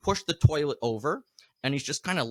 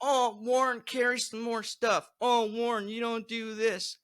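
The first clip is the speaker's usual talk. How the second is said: higher, slow mock nagging; mocking bandmates; 7 semitones higher than usual. Warren mimicking his bandmates nagging him, higher and slow. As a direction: imitate bandmates whining at him. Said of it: "they just say I'm a diva".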